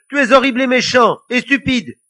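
high, very loud outburst; furious yell. A narrator's reading. Lily insulting the devil, yelling. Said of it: "hurla Lily".

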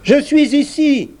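small high piping voice. A narrator's reading. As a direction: pipe it up high and thin. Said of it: "une voix dit".

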